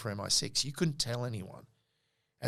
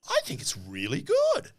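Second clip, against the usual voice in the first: way higher, squeaky self-defence; mock innocent. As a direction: chirp it up high, all innocence. His reply to Michelle.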